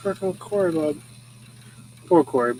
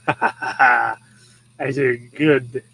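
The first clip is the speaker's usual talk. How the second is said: smug, self-satisfied dog; proud dog voice; 4 semitones lower, 4 dB louder, much slower than usual. Retold or imitated. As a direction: say it again as the dog, very pleased with himself. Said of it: "That's the look in his eyeballs".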